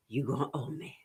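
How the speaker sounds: a low, breathy whisper of a warning; menacing whisper. Retold or imitated. Teacher voicing the lender's letter in a threatening whisper. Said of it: "So they telling you".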